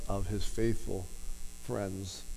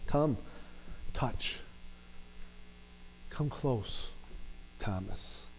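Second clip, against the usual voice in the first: much softer, slow and drawn close, fading on Thomas; tender invitation; 12 dB quieter, slower than usual. Pretend. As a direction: coax a frightened friend nearer. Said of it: "he invited him in".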